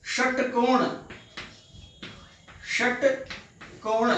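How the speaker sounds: षटकोण in careful separated syllables; retroflex sha. Shown as a demonstration. Teacher showing pupils the second sha, careful pronunciation. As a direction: start षटकोण with air from under a curled tongue. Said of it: "इसका उच्चारण देखिए".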